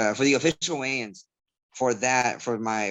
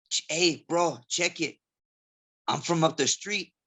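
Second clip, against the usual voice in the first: higher-pitched imitation of a Southgate local; Southgate local testing; 4 semitones higher, slower than usual. Work acquaintance testing a fellow local, imitated in a Southgate accent. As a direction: mimic a neighborhood guy's Southgate accent. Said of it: "kinda trying to test me".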